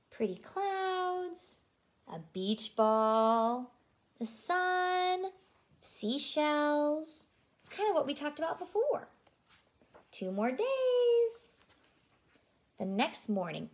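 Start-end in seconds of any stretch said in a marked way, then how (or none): 0.1-9.1 character voice. Acted. Pretend voice naming float items. 10.1-11.5 high, slow and drawn-out countdown. Acted. Dramatic countdown.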